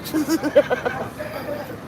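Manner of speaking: squeaky burst of laughter; high laugh. Real, not acted. Laughs after uncle quote.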